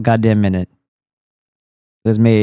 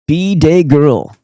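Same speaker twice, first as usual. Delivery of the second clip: ad read; 5 semitones higher, 4 dB louder than usual. Acted. Reading sponsor code in pitch.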